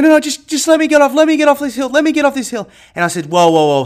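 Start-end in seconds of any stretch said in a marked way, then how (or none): 0.0-2.7 high, loud frantic pleading; panicked. Retold or imitated. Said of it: "she's like". 3.3-3.8 loud whoa. Retold or imitated. Halting panicked friend.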